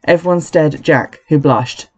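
usual speaking voice

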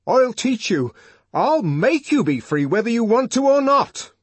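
loud, high, forceful vow; angry, determined declaration; grim threat. A narrator's reading. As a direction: force it on them, shouting with resolve. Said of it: "he went on grimly".

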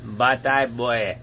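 slow, low mock Jamaican accent; bad Jamaican accent. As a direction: drag it out in a poor Jamaican accent. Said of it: "worst Jamaican accent".

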